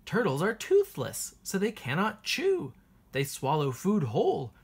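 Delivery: plain reading voice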